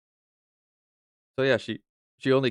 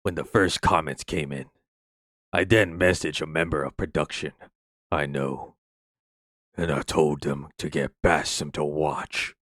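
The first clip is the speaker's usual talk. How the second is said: weird voice; 6 semitones lower than usual. Acted. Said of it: "that fucking weird voice that I did".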